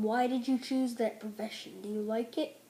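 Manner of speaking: ordinary talk, nothing marked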